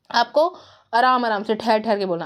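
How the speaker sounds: ordinary talk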